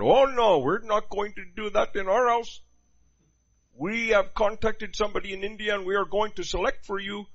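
a firm, mock Indian mother refusing; imitating Indian parents. Pastor voicing Indian parents forbidding their son to date. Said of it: "mother and father going".

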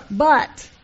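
a loud, lifted declaration of thanks; emphatic exclaim. Real, not acted. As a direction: burst out with the punchline. Punchline of her story.

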